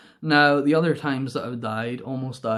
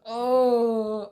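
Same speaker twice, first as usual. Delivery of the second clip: long high "ohh", sliding down; mock pained moan; 9 semitones higher than usual. Rider looking at dad after crash, mock moan. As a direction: wail wordlessly, acting out the hurt. Imitates own groan of pain.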